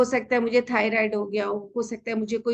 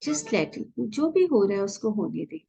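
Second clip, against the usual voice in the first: calm guided meditation voice, unhurried; 2 semitones lower than usual. Acted. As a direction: ease them gently into stillness.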